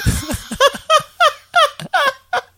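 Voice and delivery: squealing laughter; high shrieking laugh. Real, not acted. Cracking up.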